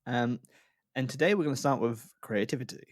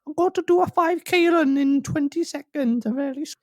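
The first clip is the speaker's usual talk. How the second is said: squeaky brother voice; 13 semitones higher, 4 dB louder than usual. Retold or imitated. Boring brother begging off for the dishes, very squeaky. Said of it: "It's very squeaky".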